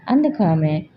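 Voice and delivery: ordinary delivery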